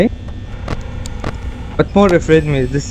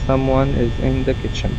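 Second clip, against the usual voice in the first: scared hushed voice; 3 semitones lower, 7 dB quieter than usual. Real, not acted. Whispering fear in horror game.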